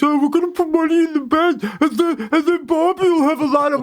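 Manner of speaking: high-pitched mock child voice; mocking kid impression. Dad mimicking kids' play to his co-host, mocking kid impression. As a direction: play a silly kid hatching a plan. Mocking kids playing with toys.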